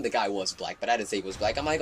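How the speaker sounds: normal talking voice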